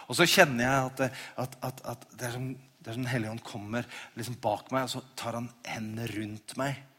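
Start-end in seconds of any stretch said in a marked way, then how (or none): none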